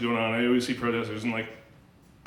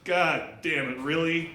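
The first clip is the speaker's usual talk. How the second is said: disgusted reaction; 6 semitones higher, 3 dB louder than usual. Retold or imitated. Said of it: "I'm like".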